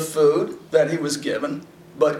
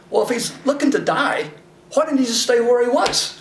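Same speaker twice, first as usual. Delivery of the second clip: high, pressing disbelief; incredulous preacher; 7 semitones higher than usual. Real, not acted. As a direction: push the absurdity, voice climbing. Preacher mocks Elijah's logic.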